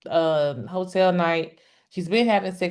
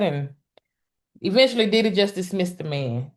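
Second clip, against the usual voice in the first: normal talking voice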